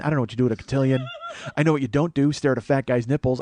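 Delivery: ordinary talk, nothing marked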